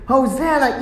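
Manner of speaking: mild high-pitched mock yell; children yelling. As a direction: call it out like a happy child. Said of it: "they're yelling and they're screaming".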